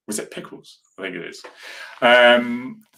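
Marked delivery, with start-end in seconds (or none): 0.1-1.4 hushed doubtful whisper to yourself; whispered aside. Real, not acted. Streamer checking a dog's name, whispered aside.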